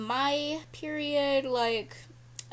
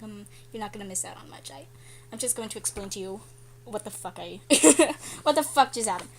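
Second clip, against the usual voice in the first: normal talking voice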